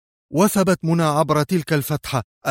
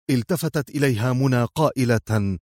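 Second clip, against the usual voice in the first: ordinary delivery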